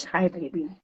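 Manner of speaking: ordinary talk, nothing marked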